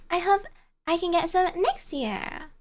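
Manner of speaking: ordinary talk, nothing marked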